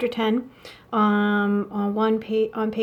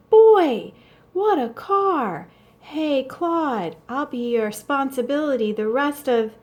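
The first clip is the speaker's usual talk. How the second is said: put-on high, loud, thrilled kid's voice; excited little boy; 5 semitones higher, 4 dB louder than usual. Young boy admiring the chauffeur's car, high excited exclaim.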